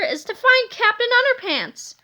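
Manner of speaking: high, sneering villain line. A narrator's reading. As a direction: sneer it out at them. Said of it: "he said with a sneer".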